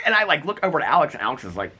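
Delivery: ordinary delivery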